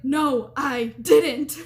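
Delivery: loud mock denial breaking into laughter; exaggerated protest, cracking up at the end; mock heated denial. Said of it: "going back and forth".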